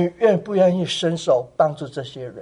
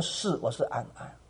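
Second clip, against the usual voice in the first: soft gentle reply; 8 semitones lower, 15 dB quieter than usual. Retold or imitated. Kind sister answers lost girl.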